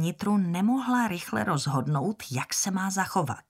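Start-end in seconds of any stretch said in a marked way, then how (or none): none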